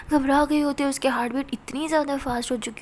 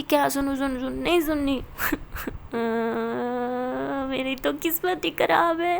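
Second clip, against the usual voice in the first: crying sulk; 4 dB louder than usual. Acted. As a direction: wail through sobs, refusing to listen. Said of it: "रोने लग जाती है". (रोते-रोते ऊँची आवाज़ में शिकायत)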